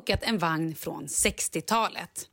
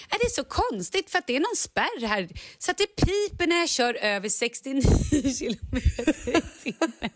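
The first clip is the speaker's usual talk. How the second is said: much higher, puzzled mother's voice; förvirrad mamma; 5 semitones higher than usual. Retold or imitated. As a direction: play the baffled mum at the wheel. Imiterar mamman som undrar. (mamman undrar förvirrat, mycket högre röst)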